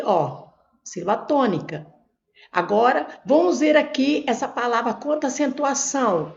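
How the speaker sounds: usual speaking voice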